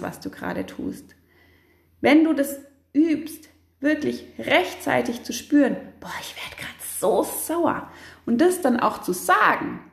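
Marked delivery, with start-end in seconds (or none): 6.0-7.9 whisper. Shown as a demonstration. Noticing anger rising early.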